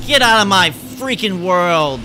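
loud, slow, drawn-out roar at an intruder; slow territorial shout; indignant yell. Real, not acted. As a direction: order the invader out forcefully. Yelling at the invader.

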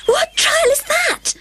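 Alice asking as she runs after the Duchess; Alice panting. A narrator's reading. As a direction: ask while running out of breath. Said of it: "Alice panted".

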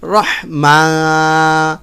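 রহমা with the final া drawn out long; রহমা held long, slowly; elongated vowel. Shown as a demonstration. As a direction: stretch the া in রহমা. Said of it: "এখানে টান হবে".

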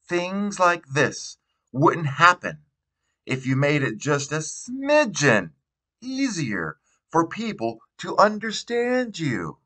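a teacher talking down sweetly to a student, voice lifted high; gentlest voice. A narrator's reading. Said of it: "saying in her gentlest voice".

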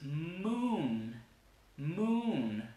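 "moon" with long M, long vowel and N; drawn-out English "moon"; long consonants. Shown as a demonstration. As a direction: stretch "moon" slowly, humming the consonants. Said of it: "cuando alargan la consonante".

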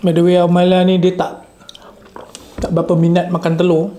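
speaking between bites; talking while chewing. Real, not acted. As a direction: mouth partly full. Seen 0: Man keeps eating by hand with food on tray while talking.

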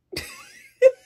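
loud, high-pitched laugh; high burst of laughter. Real, not acted. Laughs at her own story.

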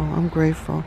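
ordinary delivery

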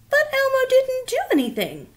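high modest Elmo; Elmo's high character voice. Acted.

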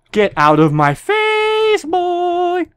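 put-on slow, stretched yell with a high squeal on boy; mock yell. Gamer yelling at an enemy player, slow and hammed-up. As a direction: drag it out like a cartoon bully.

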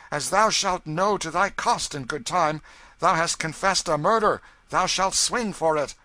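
loud, high-pitched royal accusation; angry threat. A narrator's reading. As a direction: threaten him with full voice. King threatens the man who mocked him.

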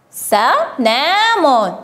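very slow, syllable by syllable; slow sounding-out. Shown as a demonstration. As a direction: stretch the word so each sound stands out. Teacher sounds out written word.

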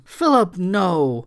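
high, loud outcry of objection; cried protest. A narrator's reading. Thomasina cries out as Philip spares Clara, protesting. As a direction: cry out to stop him. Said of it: "Thomasina cried".

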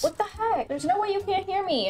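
real exasperation without shouting; exasperated anger. Real, not acted. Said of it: "she's getting so angry".

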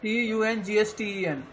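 letters of tungsten spelled out slowly and evenly; teacher slowly spelling out tungsten for the class. Shown as a demonstration. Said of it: "tungsten की spelling correct कर लेना".

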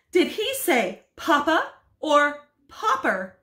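high, slow incredulous question; astonished. Acted. Wife asks husband about the broadcast, incredulous. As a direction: ask in disbelief.

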